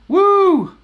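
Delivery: high, loud happy whoop; excited whoop. Real, not acted. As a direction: let out a big cheering "Woo!" to the chat. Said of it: "I don't know why I'm yelling".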